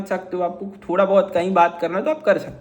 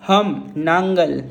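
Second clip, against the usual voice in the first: slow Tamil demo; 3 semitones lower, much slower than usual. Shown as a demonstration. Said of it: "इसको मैं तमिल में कैसे बोलता हूँ?".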